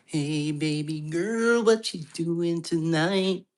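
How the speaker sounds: high, very slow crooning come-on; hammed-up slow sweet-talker voice; slow seductive pickup line. Shown as a demonstration. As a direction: drawl it out like a smooth flirt. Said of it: "You like that? That's a freebie".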